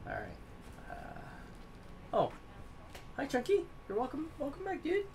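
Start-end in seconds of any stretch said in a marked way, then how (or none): 3.2-5.1 high pet voice. Real, not acted. Greeting a dog-like companion warmly.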